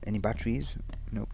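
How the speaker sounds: normal talking voice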